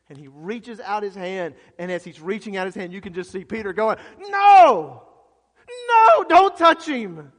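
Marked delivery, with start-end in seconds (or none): 4.2-7.3 very high, very loud drawn-out shout; full-throated panicked yell; screaming in alarm. Acted. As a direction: scream a horrified warning to stop him. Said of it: "Everybody's screaming".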